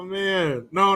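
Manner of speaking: usual speaking voice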